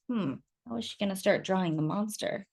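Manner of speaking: ordinary delivery, nothing marked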